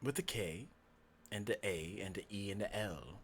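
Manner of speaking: hushed, measured spelling; quiet, mysterious stranger; creepy fox-man, obliging. Acted. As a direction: spell it out softly in character. [name] spells his name in character.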